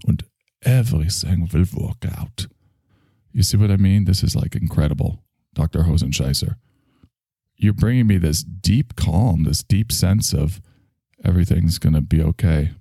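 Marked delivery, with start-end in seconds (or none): none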